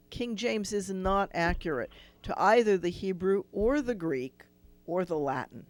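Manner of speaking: ordinary talk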